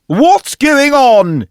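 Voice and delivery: high, very slow, loud shout; king shouting. Acted. King bursting in, shouting in alarm. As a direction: bellow in alarm. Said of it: "he shouted".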